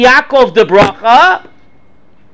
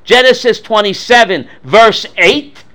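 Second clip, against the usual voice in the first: usual speaking voice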